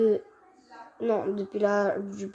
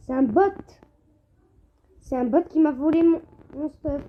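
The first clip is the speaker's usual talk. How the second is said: raging gamer; 5 semitones higher, 4 dB louder than usual. Real, not acted. Bot stole his stuff.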